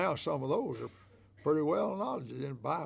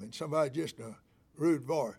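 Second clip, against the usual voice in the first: ordinary delivery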